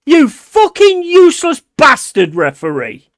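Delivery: genuinely incensed football fan; angry rant. Real, not acted. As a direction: rage in disbelief at a bad decision. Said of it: "I know I'm getting angry".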